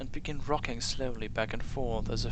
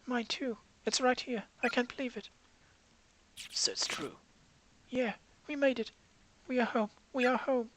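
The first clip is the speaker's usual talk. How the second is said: put-on higher, relieved girl voice; she's home at last; joyful schoolgirl; 11 semitones higher than usual.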